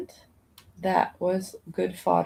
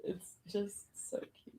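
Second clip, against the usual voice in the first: whisper; 17 dB quieter than usual. Real, not acted. Whispered aside.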